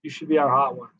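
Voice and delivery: mock fan urging, in the speaker's own voice; lightly voiced fan pitch; imitating a fan. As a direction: parrot what people keep telling you. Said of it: "For every time somebody has said".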